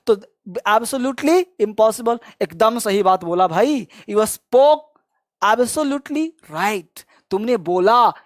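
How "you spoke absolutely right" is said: very slow, clear model phrase; slow drill. Shown as a demonstration. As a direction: stretch each word for the class. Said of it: "teacher models phrase slowly". (धीमा अभ्यास उच्चारण)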